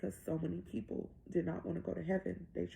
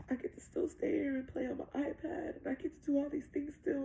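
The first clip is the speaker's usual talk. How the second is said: lightly voiced child, pitched higher; a hint of a little girl's higher voice; young daughter; 6 semitones higher than usual. Six-year-old explains to mom why she's staying, lightly voiced child.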